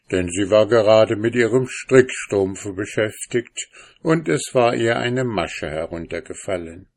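plain reading voice

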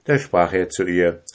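ordinary delivery

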